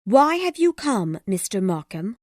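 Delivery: high, pointed, cold question. A narrator's reading. Woman greets unwelcome Markham, cold and high. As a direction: demand it coldly in a high female voice. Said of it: "she asked coldly".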